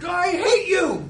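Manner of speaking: high, loud, drawn-out mock tantrum; angry three-year-old. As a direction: yell it like a furious small child in an acted tantrum. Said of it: "gets angry".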